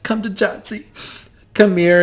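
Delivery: high, sweet beckoning; a grandmother opens her arms, high and sweet; grandmotherly. Acted. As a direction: call her in for a hug. Grandmother calls Gal to hug.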